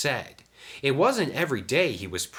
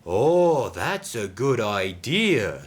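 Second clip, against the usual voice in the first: louder drawn-out oh, slow relish; snickering; 3 semitones higher, 5 dB louder, slower than usual. A narrator's reading. Sidney approves of Diesel's scheme, snickering. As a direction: savour the sly idea slowly. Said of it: "snickered Sidney".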